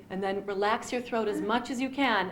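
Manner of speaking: normal talking voice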